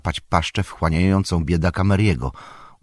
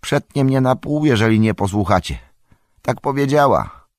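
higher, alarmed cry; calling out; 6 semitones higher than usual. A narrator's reading. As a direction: call out a desperate warning. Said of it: "wołał Merry".

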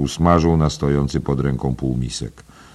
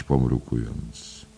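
ordinary delivery, nothing marked

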